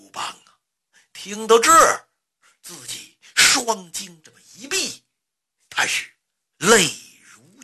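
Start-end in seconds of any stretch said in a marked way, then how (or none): none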